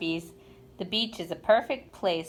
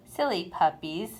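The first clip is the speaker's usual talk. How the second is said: plain reading voice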